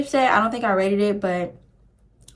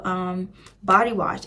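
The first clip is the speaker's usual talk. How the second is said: ordinary talk, nothing marked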